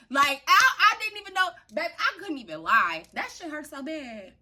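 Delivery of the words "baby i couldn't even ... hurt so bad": ordinary delivery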